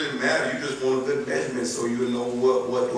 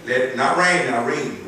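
ordinary delivery

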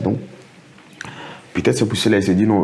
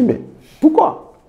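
a high, louder disbelieving pourquoi; astonished; 4 dB louder, much slower than usual. Retold or imitated. The employee asking his boss why he is dismissed, astonished. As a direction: let the why jump up in shock. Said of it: "J'étais étonné".

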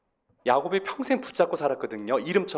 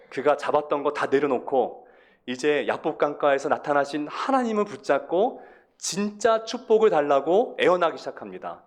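usual speaking voice

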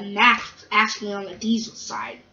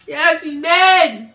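very slow, high, loud wail; drawn-out wail; 6 semitones higher, 8 dB louder, much slower than usual. Acted. Gordon insists to the controller, drawn-out wail. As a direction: stretch each word into a cry. Gordon repeats his answer more intensely.

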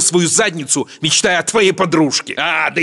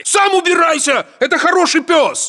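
raised, high snapping defence; angry retort; 9 semitones higher than usual. Acted. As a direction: tell him off for the dog. Soldier defending the dog.

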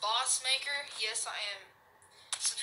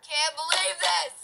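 frustrated yell; 6 semitones higher, 9 dB louder, slower than usual. Real, not acted. Repeats after losing.

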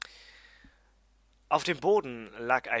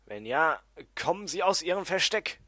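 calling out into the dark; raised, searching call for anyone there; Rufen; 5 semitones higher, 7 dB louder than usual. Acted. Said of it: "rief ich ins schwache Licht". (lautes, fragendes Rufen)

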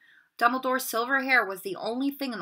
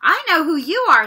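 loud, higher blurt; sudden; 7 semitones higher, 12 dB louder than usual. A narrator's reading. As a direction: jump in eagerly. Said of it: "said Ron suddenly".

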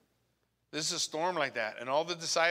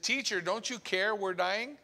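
a touch of panic, rising on care; lightly voiced frightened disciples; understated alarmed cry; mild desperate plea; 6 semitones higher than usual. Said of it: "they woke him and said".

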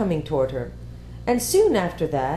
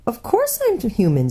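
put-on girl character voice; 6 semitones higher, 3 dB louder than usual. A narrator's reading.

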